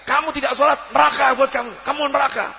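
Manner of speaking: high, accusing shout; a self-righteous man yelling at someone who skips prayer; mock yell. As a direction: jab the finger and shout hellfire at him. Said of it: "yang satu teriak".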